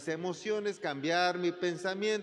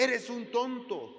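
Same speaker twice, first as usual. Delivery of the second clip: higher, slow and scornful: "you fool"; hurt, accusing voice; 7 semitones higher than usual. Acted. Preacher voicing a wounded person insulting another, accusing. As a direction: spit the insult at them, wounded.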